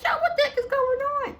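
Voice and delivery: very high, loud comic shriek; hammed-up high-pitched yell; mock screaming. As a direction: shriek it in exaggerated panic, half laughing. Acts herself screaming while being punched.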